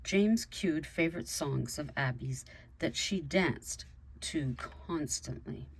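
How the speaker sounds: ordinary delivery, nothing marked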